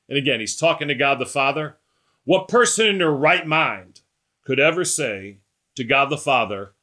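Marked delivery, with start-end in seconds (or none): none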